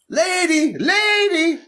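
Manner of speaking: high calling shout, Lady, lady. Acted.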